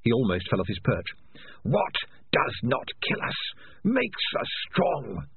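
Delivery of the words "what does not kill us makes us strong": slow, drawn-out sinister creature; voice of Spider. A narrator's reading. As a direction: stretch each word out slowly like a voice inside someone's head. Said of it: "said the voice of Spider".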